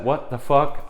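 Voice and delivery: normal talking voice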